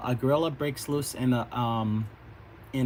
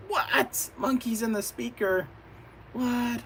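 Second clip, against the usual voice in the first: high, startled "What?"; cartoon bystanders, startled and high; surprised cry; 9 semitones higher, slower than usual. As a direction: gasp it out as a shocked bystander. Characters surprised by monkeys.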